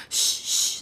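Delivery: breathy unvoiced shh to scare off a hare; shooing hush. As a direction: hush the rabbit away. Girl shooing the hare away.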